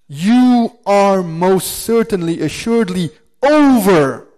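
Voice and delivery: slow, loud, with weight on each phrase; emphatic reading. Shown as a demonstration. Preacher showing the verse's emphasis in English, slow and loud. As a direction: lay heavy weight on every word. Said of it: "three particles of emphasis".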